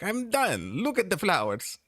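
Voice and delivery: high squeaky mortician voice. Retold or imitated. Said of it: "Be like".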